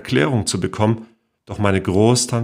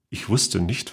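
usual speaking voice

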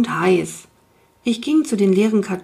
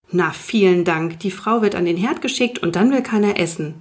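ordinary delivery, nothing marked

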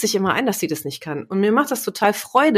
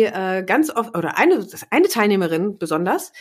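normal talking voice

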